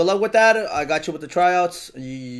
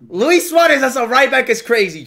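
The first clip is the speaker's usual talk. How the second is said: high, loud, amazed exclamation; incredulous outburst; 6 semitones higher, 6 dB louder than usual. Real, not acted. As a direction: yell the absurd pick to chat. Repeats it in disbelief.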